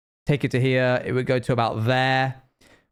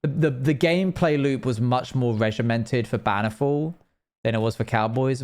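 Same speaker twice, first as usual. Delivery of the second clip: usual speaking voice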